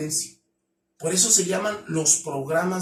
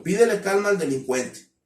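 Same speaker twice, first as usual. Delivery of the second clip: ordinary talk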